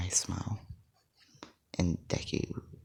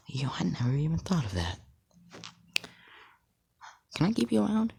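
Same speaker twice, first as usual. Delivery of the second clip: higher-pitched amazed reply; impressed surprise; 10 semitones higher than usual. Acted. Scarlet Witch hears ideas for her powers, impressed surprise. Heroine's rejected excited reply, acted.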